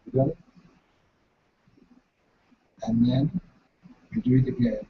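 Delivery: ordinary talk, nothing marked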